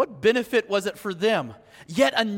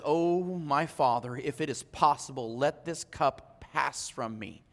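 hushed prayer; 9 semitones lower, 9 dB quieter than usual. Acted. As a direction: pray it low as Jesus in the garden. Jesus praying before his arrest.

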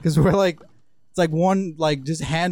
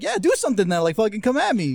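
high-pitched goading; mock defiant taunt, pitched up; retold daring comeback; 6 semitones higher than usual. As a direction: imitate a kid egging on a fight. Acted answer to fight challenge.

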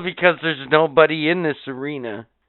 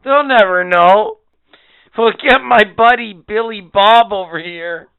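mock promoter; 7 semitones higher, 8 dB louder than usual. Mocking the producers' voice.

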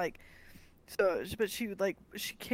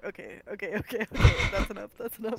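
okays building to a loud burst, then calling enough; mock overwhelmed protest; 7 dB louder than usual. Friends halfway through a drinking game, hammed-up loud protest. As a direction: playfully beg it to stop as the drinks pile up. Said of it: "and we're like".

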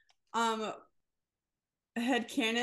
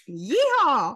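loud exaggerated yeehaw; big hammed-up cowboy holler; mock cowboy whoop; 3 semitones higher, 10 dB louder than usual. Imitates the book character's cowboy cry.

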